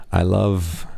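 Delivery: usual speaking voice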